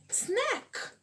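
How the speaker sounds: snack said high like a snake hiss; hissing snake imitation. Waiter imitating a snake to explain the dish, hissing high. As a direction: drag out the s like a snake. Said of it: "E fez assim".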